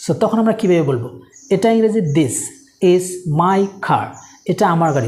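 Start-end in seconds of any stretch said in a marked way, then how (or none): 2.0-4.1 very slow, carefully spaced words; dictation-slow teacher read; slow model sentence. Shown as a demonstration. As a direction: say it slowly so learners can follow. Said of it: "teacher models the English sentence".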